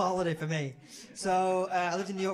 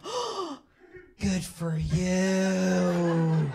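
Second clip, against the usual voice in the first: gushing American approval; 5 dB louder, much slower than usual. Acted. American hearing you stopped drinking, gushingly supportive. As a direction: stretch it into hammed-up congratulation.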